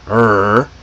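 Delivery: er growled like a tiny puppy. Shown as a demonstration. Teacher showing students the American R, little growl. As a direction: hold er as a small growl, tongue curled. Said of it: "how to growl like a tiny puppy".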